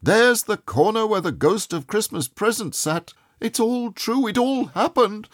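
breathless joy at it all being true; overjoyed. A narrator's reading. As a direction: tumble it out in happy wonder.

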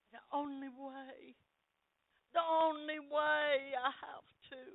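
high, loud, slow pleading with a break of tears; God's tearful longing. Acted. God pleading with his lost family, tearful and loud. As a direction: ache for the lost family, drawn out.

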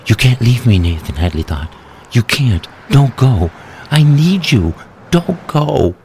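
wife begging her dying husband to stay, high and desperate; desperate pleading. Acted. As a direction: beg him not to die.

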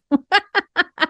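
short bright giggle; high laugh. Real, not acted. Laughing at French accent idea.

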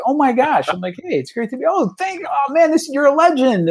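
high, gushing awe with a burst of a cry; star-struck. Retold or imitated. Organizer meeting the UK players, high gushing awe. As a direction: babble like a fan meeting idols. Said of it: "And I'm like".

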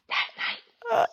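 a hushed, high ironic repeat; ironic whisper. Real, not acted. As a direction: let the irony land in a hush. Ironic echo for effect.

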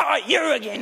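lightly mimicked furious neighbour; annoyed yell. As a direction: snap it at the unwelcome visitor again. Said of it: "started yelling at me".

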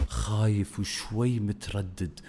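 soft, halting, mock-scared delivery; acted fearful hesitation. Coach acting out a B-roll example, mock fearful and hesitant. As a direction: shrink the voice as if hesitant and afraid. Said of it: "راح تشوفون الناس الحين خايفين".

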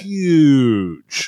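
HUGE stretched long on a falling pitch; drawn-out emphasis. Real, not acted. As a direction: draw out "huge" to show the sheer size. Emphasises how big six dB is.

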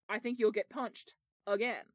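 ordinary delivery

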